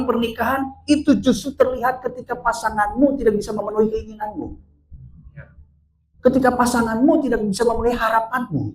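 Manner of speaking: high-pitched, driving sermon voice; impassioned preaching. Acted. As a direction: press the point home to the congregation. (khotbah berapi-api bernada tinggi)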